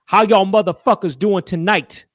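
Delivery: mock crude showman hello; hammed-up rowdy host; loud Teller bit. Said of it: "he goes like this".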